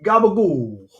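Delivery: put-on Italian-American gabagool, light accent; mock Italian accent. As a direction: drop the ending like a Jersey Italian. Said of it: "an accent kicks in".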